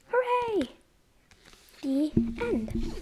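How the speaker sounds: high, bright closing cheer; cheerful hooray. Acted. Reader ending the storybook with a happy cheer. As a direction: finish the book with a cheer.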